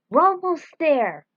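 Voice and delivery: high, loud keen announcement; eager call. Acted. Racer calling to his rival, high and eager. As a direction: urge the team on.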